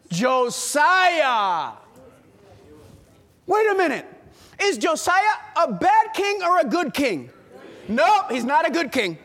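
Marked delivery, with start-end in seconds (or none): none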